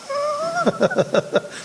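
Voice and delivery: high drawn-out laugh. Real, not acted. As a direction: break into a high, long laugh. Laughing amid the retold story. (צחוק גבוה וממושך)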